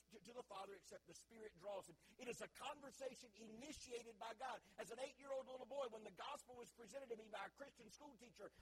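normal talking voice

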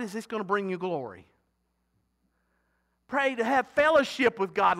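ordinary talk, nothing marked

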